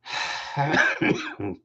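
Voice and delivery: long voiceless sigh; deep sighing breath. Shown as a demonstration. Coach modelling deep breaths to release tension, long sigh. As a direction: breathe out slowly and audibly. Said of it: "Take some deep breaths".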